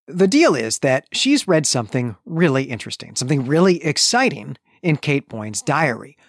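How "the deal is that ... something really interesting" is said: ordinary talk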